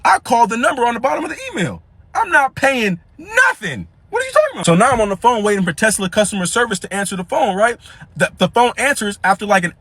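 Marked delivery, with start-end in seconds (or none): 2.1-4.6 outraged refusal. Retold or imitated. Outraged at deductible email.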